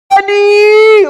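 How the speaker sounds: loud high drawn-out yell; big, high-pitched shout; yelled DADDY. Shown as a demonstration. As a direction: holler it loud and proud, stretching the end. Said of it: "you gotta yell it".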